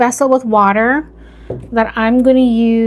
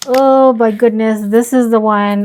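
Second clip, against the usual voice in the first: louder, startled oh my goodness; alarmed; 6 dB louder than usual. Real, not acted. Finding the worst-rotted cutting in the bucket, alarmed. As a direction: blurt it out in alarm.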